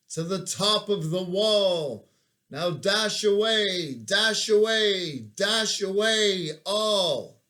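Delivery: loud, high, rousing chant of reindeer names; loud recitation. Acted. Reciting the Christmas poem to the troops, loud rousing chant. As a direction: call out the reindeer like a driver.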